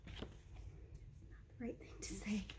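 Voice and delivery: soft unvoiced whisper; whispered aside. Real, not acted. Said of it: "whisper aside".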